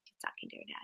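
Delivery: whispered aside. Real, not acted. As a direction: lean in and whisper it to the class. Teacher aside to class.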